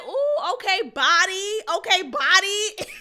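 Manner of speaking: high, loud mock catcall "Ooh, okay, body"; mocking him. Mocking the groom's line to his bride, high and loud. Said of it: "He'll say".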